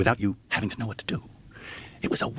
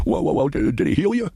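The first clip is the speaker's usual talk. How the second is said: loud, high stammering old priest impression; high-pitched, halting comic old-man voice; Jimmy Stewart crossed with Elmer Fudd; 11 semitones higher, 6 dB louder than usual. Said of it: "a cross between Jimmy Stewart and Elmer Fudd".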